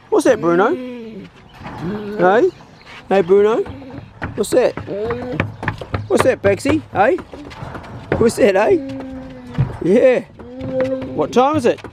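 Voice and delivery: high, rising questions to the dogs; excited high dog-talk before feeding; pet talk. Real, not acted. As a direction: tease the dogs, voice way up.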